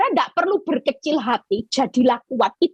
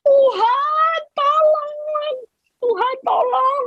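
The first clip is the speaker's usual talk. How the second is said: high desperate cry for help; crying out to God; slower than usual. Retold or imitated. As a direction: call out to God, pleading, much higher. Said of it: "Selalu kita kalau teriak". (teriakan minta tolong kepada Tuhan)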